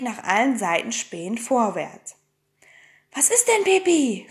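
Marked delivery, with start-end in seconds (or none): 3.1-4.3 high, uneasy question. A narrator's reading. Annika asks Pippi what she noticed, uneasy. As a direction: ask nervously, pitched up. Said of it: "fragte Annika unruhig".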